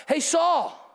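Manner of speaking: high-pitched call to someone far off; yelling. Acted. David calling from the cave to Saul on the other hill, yelling. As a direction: holler it across a distance, pitch leaping up. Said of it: "he yells and shouts".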